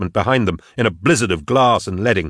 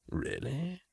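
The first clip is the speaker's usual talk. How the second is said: very soft, light question; softly; 5 semitones higher, 19 dB quieter than usual. Acted. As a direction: barely voice it, softly. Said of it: "Karl said softly".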